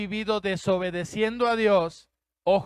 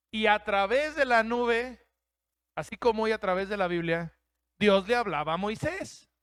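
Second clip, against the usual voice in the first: normal talking voice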